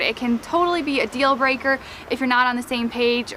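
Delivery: ordinary talk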